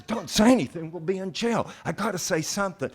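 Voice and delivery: high, hurried panicky arguing; hammed-up alarm at being pulled over; mock panic. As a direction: act out a worked-up friend, pitch way up. Acted friends panicking at police stop.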